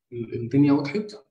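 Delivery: ordinary talk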